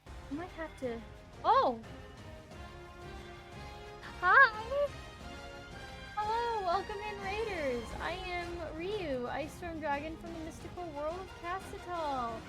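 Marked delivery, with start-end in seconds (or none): 1.4-1.8 surprised. Real, not acted. Startled mid-sentence on stream. 4.2-12.3 high, bright greeting for raiders; bubbly VTuber hello, pitched up; cute anime streamer. Acted.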